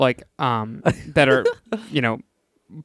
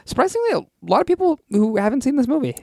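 excited; 12 semitones higher, faster than usual. Real, not acted. Excited recommendation.

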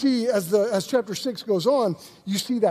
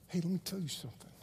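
low, hushed, measured warning; soft, lowered voice of quiet authority; quiet rebuke; 5 semitones lower, 14 dB quieter than usual. Acted. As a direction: confront the tempter softly and firmly. Jesus quietly confronts Satan, acted.

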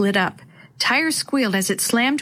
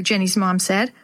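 usual speaking voice

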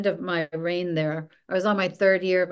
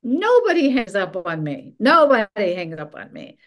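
loud, raised imitation of a furious boss; retold screaming; 2 semitones higher, 6 dB louder than usual. Boss calls back after being hung up on, mock screaming. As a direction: belt it out as the outraged boss. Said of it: "he wouldn't stop screaming".

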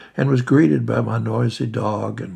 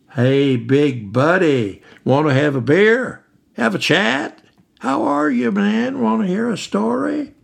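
loud phone voice; 9 semitones higher, 7 dB louder than usual. Acted. Late-night caller bellowing a cheerful greeting down the phone. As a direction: yell cheerfully down the phone line. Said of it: "He was talking at the top of his phone volume".

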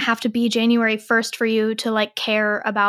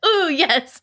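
overeager; 6 semitones higher than usual. Pretend. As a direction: overdo the eagerness for a laugh. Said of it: "I seemed way too eager for that".

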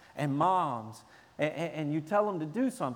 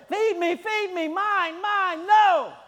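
put-on high, loud wailing baby demands; mock infant tantrum; screaming baby; 13 semitones higher, 7 dB louder, slower than usual. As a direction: scream like a hungry baby in the crib. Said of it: "like the baby screaming in the crib".

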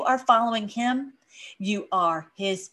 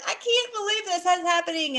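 frustrated disbelief; 9 semitones higher than usual. Retold or imitated. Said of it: "have we been like".

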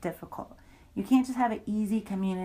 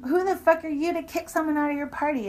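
higher, heated retold accusation; raised, angry retelling of the confrontation; pissed-off accusation; 8 semitones higher, 3 dB louder than usual. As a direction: throw the groping back in her face, heated. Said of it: "And I got really pissed".

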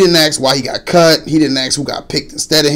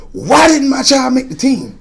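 higher, mock-angry parent; mock yelling; 10 semitones higher than usual. Parent complaining to coach on Facebook, mock yelling. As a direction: ham up an all-caps complaint. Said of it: "She yelled on the Facebook?".

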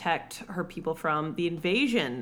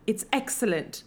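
put-on British accent, slightly raised; 3 semitones higher than usual. Podcaster mocks the miscast love interest, in a British accent. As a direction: parody an actor's straight-up British accent. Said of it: "just has a straight-up British accent".

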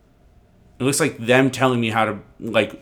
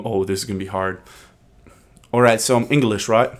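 ordinary talk, nothing marked

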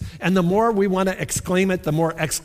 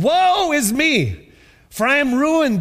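anguished cry; 8 semitones higher, 5 dB louder than usual. Acted. Isaiah lamenting in vision.

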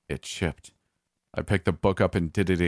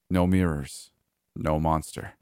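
plain reading voice

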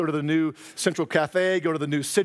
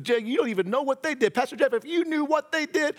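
high, defensive objection; indignant protest; 8 semitones higher than usual. Retold or imitated. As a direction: raise the voice in protest. Congregant objection acted out.

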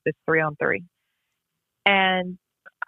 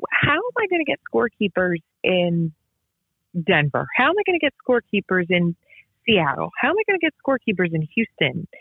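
overwhelmed; 2 semitones higher than usual. Retold or imitated. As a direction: rattle off each city, swamped. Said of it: "I'm like".

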